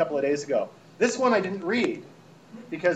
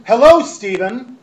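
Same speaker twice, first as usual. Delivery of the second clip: loud, higher, drawn-out greeting; yelling email writer; 5 semitones higher, 11 dB louder, slower than usual. Acted. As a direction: read the exasperated email as a shout. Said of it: "she's clearly yelling".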